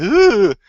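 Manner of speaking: loud, high-pitched mock squeal; hammed-up YouTuber scream, high and loud; mock screaming "ooh". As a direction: imitate an idiot screaming at a camera. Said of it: "the idiot screaming at a camera going".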